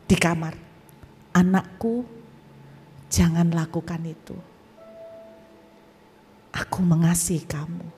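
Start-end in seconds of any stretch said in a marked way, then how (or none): 1.3-7.9 gentle male voice. Retold or imitated. Unseen voice to a despairing young man, low and tender. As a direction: speak tenderly with long pauses.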